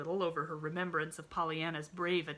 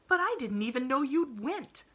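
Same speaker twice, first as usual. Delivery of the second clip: high, louder cry; cried out; 9 semitones higher, 8 dB louder than usual. A narrator's reading. Nancy crying out to the little girl. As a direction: call it out in alarm. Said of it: "cried Nancy".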